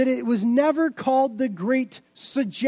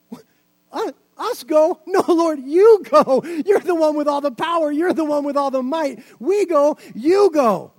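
much higher and louder, protesting in disbelief; startled disciple; 4 semitones higher, 6 dB louder than usual. Pretend. Disciple protesting the Lord's command, high and loud in disbelief. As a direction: push the job back in alarm. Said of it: "if you were the disciple".

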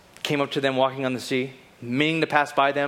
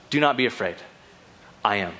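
lower, measured reassurance after the panic; calm reassurance; 5 semitones lower, faster than usual. Acted. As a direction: settle them gently, with pauses. Jesus calms the frightened disciples.

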